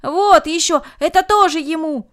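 loud high calls; shouting. A narrator's reading. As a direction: shout out generously. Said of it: "А другие кричали".

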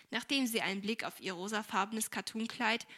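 ordinary delivery, nothing marked

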